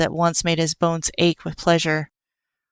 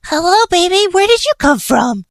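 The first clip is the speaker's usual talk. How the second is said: high, louder sing-song coo at a kitten; cooing baby talk; 13 semitones higher, 4 dB louder than usual. Acted. Killer coos baby talk to the stray kitten, high and sweet.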